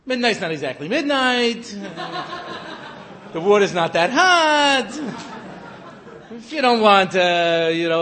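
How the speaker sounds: put-on laughing, loud Israeli accent wheeling and dealing; sly, chummy Israeli accent, half laughing. Israeli official in joke, bargaining.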